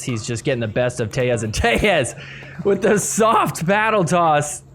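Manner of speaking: usual speaking voice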